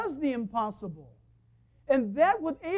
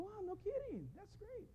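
higher and much softer, wowed; amazed; 8 semitones higher, 17 dB quieter than usual. Retold or imitated. The preacher wowed by the little man's film career, soft and higher. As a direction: breathe out mild amazement. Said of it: "and I go".

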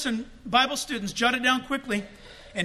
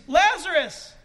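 raised, higher calling voice; loud call; 6 semitones higher, 4 dB louder, slower than usual. Acted. Preacher re-enacts Jesus singling out Lazarus, loud call. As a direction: call the name out to someone. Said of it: "Then he says".

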